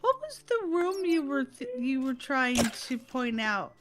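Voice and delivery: usual speaking voice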